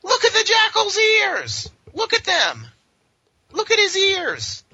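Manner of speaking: high, slow squealing delight over the ears; hammed-up fangirl shriek, drawn out; gushing squeal. Comic host squeals slowly over the Jackal's ears, high and gushing.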